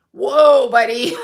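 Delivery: surprised whoa. Real, not acted. Reacting to a topless Putin comment.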